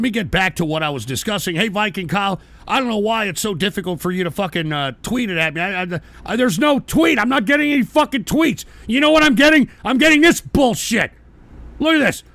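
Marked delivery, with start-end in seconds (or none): 6.4-12.2 high, raised-voice complaint; indignant, insistent shouting; angry ranting. Real, not acted. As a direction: rail at the trolls in exasperation. Rants about getting only troll messages.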